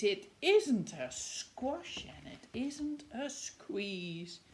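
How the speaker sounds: ordinary delivery, nothing marked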